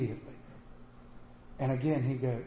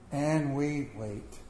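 slow, weary and we wait; drawn-out waiting; 5 dB quieter, much slower than usual. Real, not acted. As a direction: stretch it out to feel the hours. Endless waiting at the hospital.

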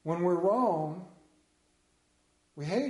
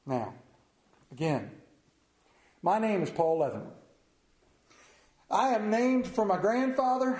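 usual speaking voice

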